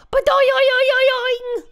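cartoon boing made with the voice; springy boing noise. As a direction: imitate a tail twanging like a spring. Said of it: "and it used to go".

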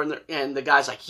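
ordinary talk, nothing marked

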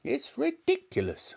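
high, scoffing granny voice; scornful grandmother. A narrator's reading. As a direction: scoff as a cross old woman. Grandmother scolding George.

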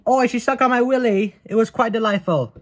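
put-on a silly, high posh British accent; mock British accent. Retrying the British bit after failing, mock British accent. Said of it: "should I do, like, British?".

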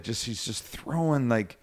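normal talking voice

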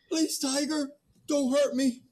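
high fearful begging; frightened plea. Acted. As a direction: plead with the tiger for your life. Man begs the attacking Tiger.

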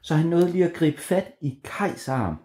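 plain reading voice

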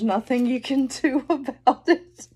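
real laughter breaking into the words; giggling at a card; laughing. Real, not acted. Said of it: "I'm laughing".

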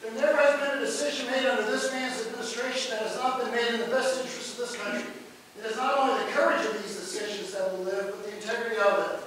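measured, formal read of a tribute; formal quotation reading. Acted. Lecturer reading a tribute aloud, formal and measured.

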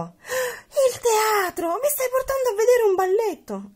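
high, loud thrilled cry, first words stretched; excited shout. Acted. Olivia arriving at the theatre, shouting with joy. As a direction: burst out with delight at the theatre. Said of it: "Olivia gridò".

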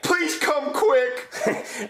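very slow pleading; drawn-out plea. Acted. Child to police on phone, slow plea. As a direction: stretch out the plea.

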